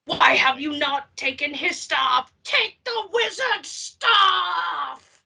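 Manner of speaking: rising to a slow, high shouted command; furious shouted command; angry shout. Acted. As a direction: start firm then bellow the order. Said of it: "Gandalf sees through".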